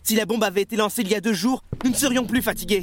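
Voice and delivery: pitched high and defensive, pushing back at their stares; a man at breaking point lashing out; agitated outburst. Acted. Tired bomber snaps at comrades.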